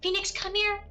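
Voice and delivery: high call to a dog. Retold or imitated. Owner calls the puppy back over, high-pitched call.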